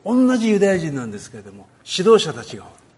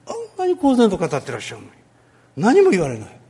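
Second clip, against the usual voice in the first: ordinary talk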